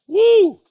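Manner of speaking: high loud cheering woo; high-pitched whoop of approval; excited whoop. Real, not acted. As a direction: cheer out in delight.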